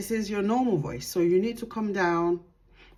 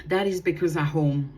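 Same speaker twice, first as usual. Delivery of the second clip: ordinary delivery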